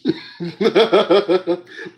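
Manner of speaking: high burst of laughter; cracking up; loud laugh. Real, not acted. Big laugh.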